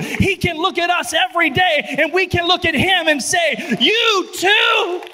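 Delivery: loud, high, building sermon cry; preaching at full force. Acted.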